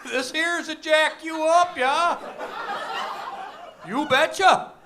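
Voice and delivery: very high, loud mock gangster in North Dakota accent; tough-guy talk in a North Dakota accent; hammed-up Fargo gang member. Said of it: "with that accent".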